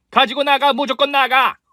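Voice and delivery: high, shrill-pitched yell to get out; shrieking order. Acted. Mother-in-law screaming at the caterers to leave. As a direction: scream them out of the house. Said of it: "무조건 나가라고 소리치는".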